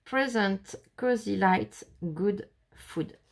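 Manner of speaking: English words read with a French accent. Real, not acted. Vlogger reading an English card to viewers, French accent. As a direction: read each English phrase separately, French accent showing. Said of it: "mon superbe accent anglais".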